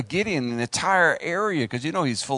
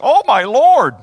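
very loud, high mock-shocked cry; hammed-up shout of alarm; exclaimed in context; 8 semitones higher, 13 dB louder than usual. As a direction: blurt it out in utter disbelief. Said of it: "read it in context".

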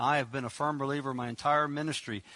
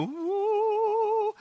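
1 mock religious ooh. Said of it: "we get so King Jamesy and so religious".

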